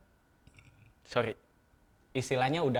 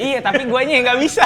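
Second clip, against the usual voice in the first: laughing; 12 semitones higher, 14 dB louder than usual. Real, not acted. Self-mocking, laughing.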